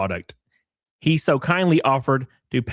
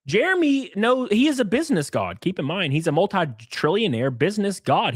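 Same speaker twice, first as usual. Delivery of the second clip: normal talking voice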